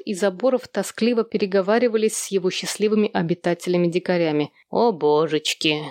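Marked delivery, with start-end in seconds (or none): 4.7-5.9 low, drawn-out sigh of dismay; slow, resigned low moan; weary sigh. A narrator's reading. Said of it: "вздохнул Эрни".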